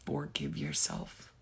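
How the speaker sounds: slow, soft and low, with long pauses; solemn reassurance. Real, not acted. Reader urging listener to forgive themselves, solemn and hushed. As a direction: gently lay down each phrase, unhurried.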